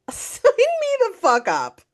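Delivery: thrilled, over-the-top high-pitched yes; eager high exclamation. Retold or imitated. To a tweet about sobbing audiences, thrilled high squeal. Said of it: "And I said".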